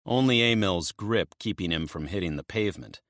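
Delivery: plain reading voice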